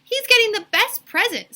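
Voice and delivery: put-on high, loud little girl voice; excited little girl. Bibi boasting about Calvin's present, high and loud. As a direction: brag it out eagerly.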